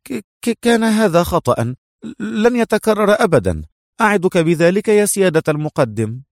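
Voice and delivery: high, stammering apology; flustered. A narrator's reading. Mona apologises to her superior for the mistake, flustered. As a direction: stumble into a high-pitched, embarrassed promise. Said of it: "وقالت بارتباك".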